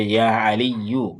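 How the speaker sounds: Ya Aliyu said slowly with a long ya; slow model reading. Shown as a demonstration. Teacher shows the class the vocative, slow and careful. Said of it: "So we say".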